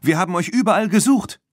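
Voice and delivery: high, loud and fast; urgent call. A narrator's reading. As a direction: hurry the words out to the children. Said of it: "rief Stéphanie".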